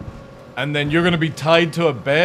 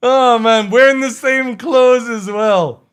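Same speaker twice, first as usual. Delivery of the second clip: loud disbelief; 9 semitones higher, 10 dB louder, slower than usual. Real, not acted. Reacting loudly to the picture.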